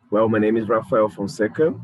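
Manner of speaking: normal talking voice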